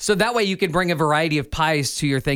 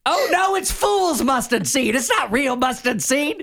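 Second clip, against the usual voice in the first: a gold panner fretting over his find; old prospector; 9 semitones higher than usual. Pretend. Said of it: "Old prospector's gonna have to".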